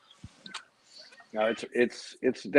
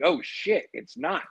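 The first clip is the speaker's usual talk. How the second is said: surprised; 10 semitones higher, 3 dB quieter than usual. Retold or imitated. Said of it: "I was like".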